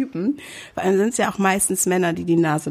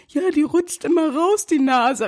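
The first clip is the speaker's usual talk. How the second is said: much higher, mocking whiny excuse; mocking the man; 8 semitones higher than usual. Retelling the masked man's excuse, a high mocking whine. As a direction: parody his lame excuse. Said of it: "Und er so".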